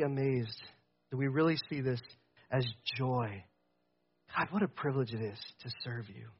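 dropping to a soft hush. Real, not acted. Pastor hushing the congregation into quiet awe. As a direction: pull way back and speak softly.

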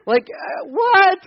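a baffled, rising what; incredulous what. Real, not acted. Reacting to blueberries as strawberries.

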